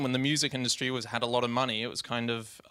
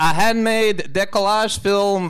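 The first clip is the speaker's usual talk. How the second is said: loud, high hammed-up Mexican accent; 6 semitones higher, 8 dB louder, slower than usual. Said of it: "In a Mexican accent".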